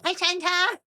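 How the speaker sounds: put-on high, bright little girl greeting Santa. Harper greeting Santa at the door, high child voice. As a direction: squeak it out like an excited small girl.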